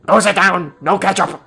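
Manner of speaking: gruff lunch lady. Retold or imitated. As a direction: bark orders like a mean lunch lady. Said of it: "she does kinda sound like a mean lunch lady".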